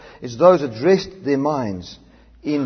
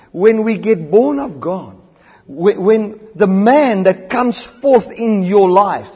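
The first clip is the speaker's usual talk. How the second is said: slow, loud, building sermon delivery; swelling preacher's cadence; preaching crescendo; 5 semitones higher, 8 dB louder, slower than usual. Acted. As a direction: drive each phrase home from the pulpit.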